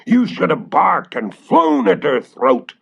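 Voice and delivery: high, loud witch's shout. Acted. Witch shouting at her dog for letting the girl escape. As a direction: scold the dog like an angry witch. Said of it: "shouted the witch".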